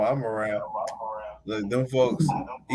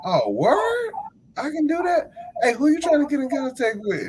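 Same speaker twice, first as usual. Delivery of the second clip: higher, playful fake excitement; mock surprise; 12 semitones higher than usual. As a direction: play along, then ask sharply. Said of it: "I was like".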